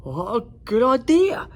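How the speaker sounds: high, bright dragon voice; squeaky young dragon, delighted; eager dragon Zog. A narrator's reading. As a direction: chirp it out like a happy young dragon. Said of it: "said Zog".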